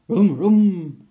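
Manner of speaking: loud vocal motor roar; revving engine noise. Acted. Jan starting the van's motor, loud imitated revving.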